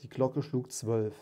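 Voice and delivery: measured storytelling voice, lightly acting the ghost and Scrooge; story narration. A narrator's reading. Narrator reading A Christmas Carol to listeners, storytelling voice. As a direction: read the tale like a fireside audiobook. Reads Dickens aloud, voicing characters.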